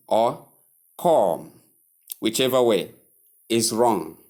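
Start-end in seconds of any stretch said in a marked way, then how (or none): 1.0-1.5 "com" with a drawn-out aw vowel; mispronunciation demo. Shown as a demonstration. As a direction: say "com" as kawm, wrongly. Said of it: "Common error".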